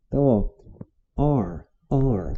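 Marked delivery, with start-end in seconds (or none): 1.4-2.4 "are" drawn out slowly, ending in an English R; English R demonstration. Shown as a demonstration. Teacher showing learners the tricky R, slow clear "are". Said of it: "o som do R é onde tá o pulo do gato".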